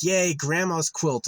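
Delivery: hammed-up mock delight; mock cheer. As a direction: fake enthusiasm over the quilt. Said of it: "was like".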